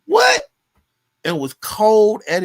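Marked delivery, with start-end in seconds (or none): 0.0-0.4 a sudden, much higher and louder "what?"; mock outrage at the boss; incredulous "what". Worker marvelling at his cold boss, loud incredulous yelp.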